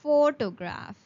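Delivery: Photograph said PHO-to-graph; stress on PHO. Shown as a demonstration. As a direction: hit the first syllable of Photograph. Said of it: "the emphasis on first three words".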